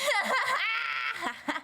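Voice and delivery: real helpless high laugh; burst of laughter. Real, not acted. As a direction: lose it completely laughing. Cracking up at the video.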